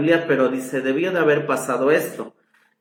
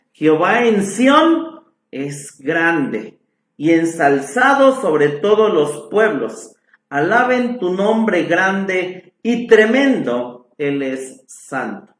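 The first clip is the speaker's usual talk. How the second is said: scripture reading; 3 semitones higher, 4 dB louder, slower than usual. Acted. Continues reading the psalm.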